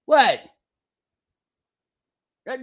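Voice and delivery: normal talking voice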